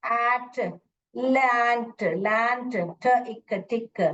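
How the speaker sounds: "एट, लैंड, टिक" sounded out separately with short vowels; short sounds. Shown as a demonstration. Teacher demonstrating short vowel sounds, chunk by chunk. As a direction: give each chunk, "एट", "लैंड", "ठ, इक", on its own. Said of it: "short sound".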